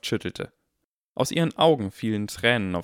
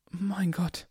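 whispered awe; 9 semitones higher than usual. A narrator's reading. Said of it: "flüsterte der alte Barkeeper".

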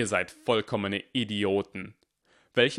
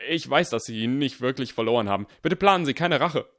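panicked pleading; 5 semitones higher, 7 dB louder than usual. A narrator's reading. Goyle panicked begging teacher.

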